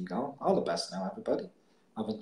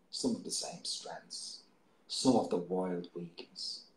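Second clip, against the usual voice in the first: poem reading; 5 semitones lower, slower than usual. Acted. Presenter reading a poem to radio listeners, hushed and soulful. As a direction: read the poem slowly, letting lines fade. Reads own poem aloud.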